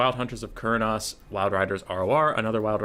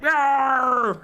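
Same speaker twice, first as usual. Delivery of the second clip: much higher, loud mock roar; gurgled roar; 6 dB louder than usual. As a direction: growl it like a gurgling soldier. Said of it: "it's more like".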